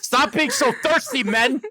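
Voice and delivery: high-pitched, exasperated mock rant; exaggerated high-pitched telling-off; mock scolding. Mock scolding of thirsty men.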